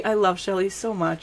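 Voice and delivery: ordinary talk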